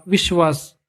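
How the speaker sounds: slightly altered voice; changed voice. A narrator's reading. A voice answering in a dream, lightly changed voice. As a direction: let the voice change a little for one word. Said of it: "आणि बदलत्या आवाजात".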